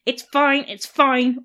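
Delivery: loud, forced it's fine; strained insisting. A narrator's reading. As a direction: insist through pain. He didn't sound fine.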